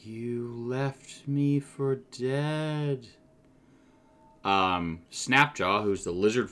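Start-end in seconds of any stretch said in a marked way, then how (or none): 0.0-3.1 slow, hollow accusation from the dead; a light undead echo of the gnome; accusing revenant gnome. Acted. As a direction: speak slowly, like a ghost holding a grudge. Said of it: "she looks at you and she says".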